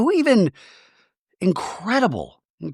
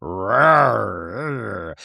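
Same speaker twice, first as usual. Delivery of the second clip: mock growl; 5 semitones lower than usual. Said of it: "Heather in slow-mo being like".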